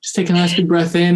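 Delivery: a hint of a gentle yoga instructor; softly spoken yoga teacher. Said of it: "this softly spoken lady".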